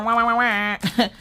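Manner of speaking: high fake baby wails; fake-sob like a whiny kid; mock crying wah. Mom mocking the kids' whining, high fake wails.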